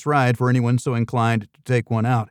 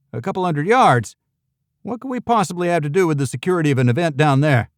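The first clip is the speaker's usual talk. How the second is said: lightly pitched-up yacht club manager, faster at the end; a hint of a prissy yuppie manager, raised voice; higher-voiced yacht manager; 5 semitones higher than usual. A narrator's reading. Yacht club manager brushing off strangers, lightly higher voice.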